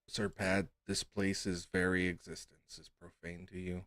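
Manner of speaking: ordinary talk, nothing marked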